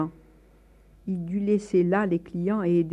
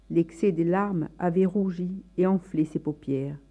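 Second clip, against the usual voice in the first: usual speaking voice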